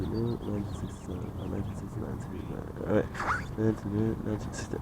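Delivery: very soft, working out the date; quietly checking and correcting yourself; thinking to oneself. Real, not acted. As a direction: mumble figures under your breath.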